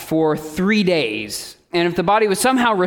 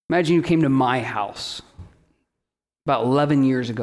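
ordinary delivery

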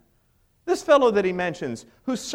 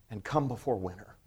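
much softer, low, lingering plea; 10 semitones lower, 9 dB quieter than usual. Retold or imitated. Paul pleading with Timothy from prison, soft and low. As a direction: say it like a lonely man's last request. Paul's plea read aloud.

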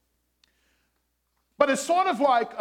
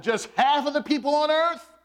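raised, emphatic sermon delivery with long pauses; preaching; 5 semitones higher, 4 dB louder than usual. Acted. Pastor preaching to his congregation, emphatic and raised. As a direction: call out to the congregation, drawing them in.

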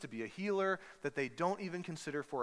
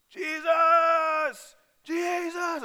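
loud, high shouting of a name; searching disciples yelling for Jesus; frantic calling; 13 semitones higher, 10 dB louder, much slower than usual. Retold or imitated. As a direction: call out across the hills.

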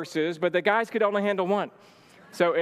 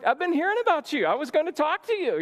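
excited greeting; 10 semitones higher than usual. Retold or imitated. Excited meeting Shannon at door.

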